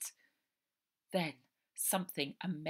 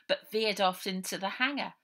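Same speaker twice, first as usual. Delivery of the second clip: plain reading voice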